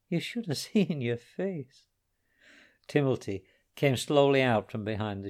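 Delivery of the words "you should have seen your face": laughing. Acted. O'Keefe laughing at the prank.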